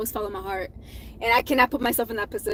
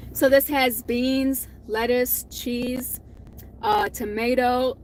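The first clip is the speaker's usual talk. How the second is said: ordinary delivery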